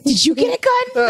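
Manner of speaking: high, excited movie-line imitation; quoted movie line. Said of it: "I love that line".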